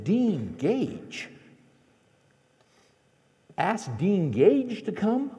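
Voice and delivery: high, rising disbelief, stretched out; incredulous echo. Retold or imitated. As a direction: repeat the absurd suggestion slowly as a question. Acts out disbelief at wife's suggestion.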